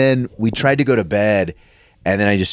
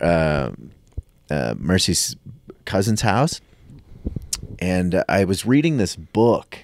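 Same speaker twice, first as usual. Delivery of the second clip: ordinary talk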